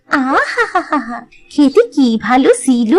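savoring sigh. Acted. Girl relishes remembered taste.

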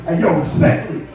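low, lightly put-on New York street voice; a hint of a Brooklyn guy saying yo, respectfully; New York accent. Imitating the Vegas guy on stage, lightly New York. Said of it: "gets up on stage every night and goes".

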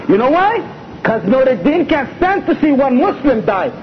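loud, driving sermon voice; full-force pulpit preaching; fiery preaching. Acted. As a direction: preach it hard to the crowd.

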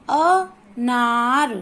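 अनार with a very long, stressed ना; short अ, then stretch the ना of अनार. Shown as a demonstration. Teacher asking pupils which syllable is strong, stressed ना. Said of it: "किस पे जोर बोला?".

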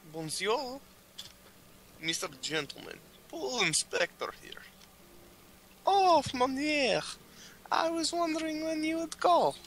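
high fancy character voice. Acted. Answering phone in character.

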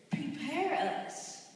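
Prepare us drawn out slowly, each word stressed; slow emphasis. Shown as a demonstration. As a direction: lean on Prepare, slow and weighty. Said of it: "slow it down and emphasize it".

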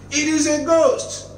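high-pitched frightened cry; a terrified shout of "ghost". A narrator's reading. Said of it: "they cried out in fear".

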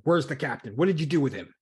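acted angry confrontation; accusing demand. Armed crewman demands answers at gunpoint.

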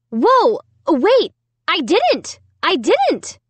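high, frantic denial. A narrator's reading. Amy frantically denying to her family, high panic. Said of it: "I cried frantically".

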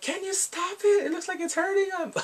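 hammed-up exasperation; mock protest. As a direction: wail the objection in a high voice. Acts out protest at balloon throwing.

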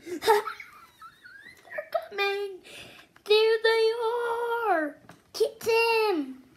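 put-on rally the kids with delight; excited. Loud excited cheer, urging children to catch them.

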